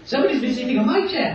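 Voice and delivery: high, fast cub voice; baby bear. Acted. Baby bear complaining about his chair, high and quick. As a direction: squeak it out quickly. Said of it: "little baby bears say".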